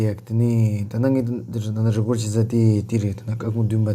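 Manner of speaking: usual speaking voice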